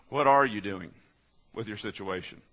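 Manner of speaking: ordinary talk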